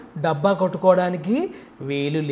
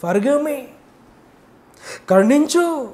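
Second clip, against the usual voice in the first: higher, pleading cry for forgiveness; crying out for mercy; 6 semitones higher, slower than usual. Acted. Sinner cries out to God for forgiveness, higher pleading call. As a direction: reach up to God, begging. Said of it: "కన్నీళ్లు విడుస్తూ ఏమంటున్నాడు".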